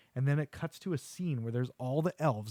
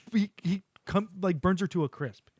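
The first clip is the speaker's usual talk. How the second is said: ordinary talk, nothing marked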